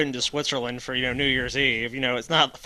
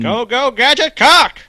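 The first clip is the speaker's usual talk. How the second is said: mock gadget battle cry; 11 semitones higher, 10 dB louder, slower than usual.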